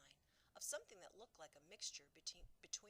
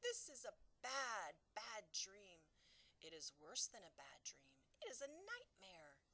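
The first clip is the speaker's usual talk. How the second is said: distressed; 6 semitones higher, 6 dB louder than usual. Acted. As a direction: dread in every word. BFG distressed over nightmare.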